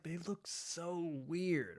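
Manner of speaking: slow, hushed, quietly mocking; mock disgusted quote. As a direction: murmur it like a baffled judge. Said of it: "I was like".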